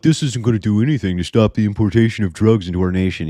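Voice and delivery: mock critic complaint; mocking naysayers. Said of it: "and it's like".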